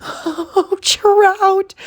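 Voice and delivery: put-on high cooing over baby Tarp and Trout, rising to a squeal; mock cooing parent. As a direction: coo to the baby, then squeal. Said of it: "be like".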